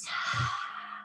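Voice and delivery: breathy sigh out. Shown as a demonstration. Breath out after reaching up.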